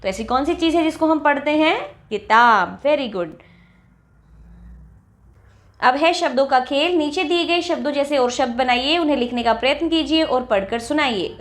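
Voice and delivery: usual speaking voice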